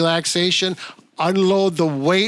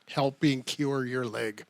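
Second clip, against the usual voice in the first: out of breath; 6 semitones lower, 11 dB quieter than usual. Real, not acted. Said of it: "I ran out of breath".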